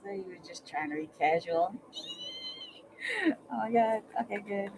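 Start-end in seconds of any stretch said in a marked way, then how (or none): none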